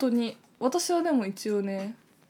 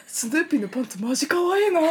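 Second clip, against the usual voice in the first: gushing; 5 semitones higher, 5 dB louder, faster than usual. Retold or imitated. Boys gush over Snoopy underwear.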